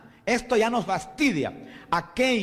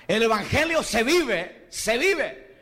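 preaching at full force; 4 semitones higher than usual. Acted. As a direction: build the fervour to the name Cristo. Preaching climax about love.

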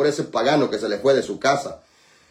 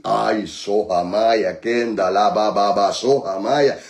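speaking in tongues; 8 semitones lower than usual. Real, not acted.

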